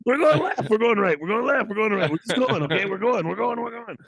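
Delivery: high, loud, excited babble; hyper ADHD impression. Host imitating hyper ADHD guest Rudy, high excited babble. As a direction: bounce off the walls, frantic. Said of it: "like Rudy".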